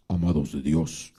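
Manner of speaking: deep, solemn put-on preacher voice; hoarse pompous preacher. Pastor mocking rivals with grand hoarse voices, very low and solemn. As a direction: boom it out like a self-important minister. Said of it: "llegaban con voz ronca".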